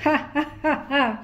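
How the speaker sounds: ho-ho laughter, acted; hearty mock laugh. Babu laughs heartily at his own joke. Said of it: "হো হো করে হেসে".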